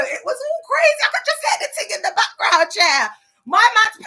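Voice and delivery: high, loud, laughing excitement; bubbling over with glee; excited laughing. Real, not acted. Excited story about boyfriend's video.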